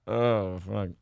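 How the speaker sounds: ordinary talk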